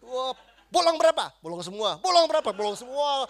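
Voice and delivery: loud, high-pitched grilling; hammed-up intimidating shout; mock intimidation. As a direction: fire it out like a roll-call interrogation.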